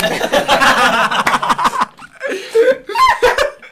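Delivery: long loud high cackle of laughter; big laugh. Real, not acted. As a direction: burst out laughing for real. Carcajada tras burla. (carcajada fuerte y aguda)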